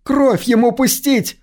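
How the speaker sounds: a woman in the mob screams for blood; woman shouting. A narrator's reading. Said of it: "выкрикнул женский голос". (выкрик женским голосом)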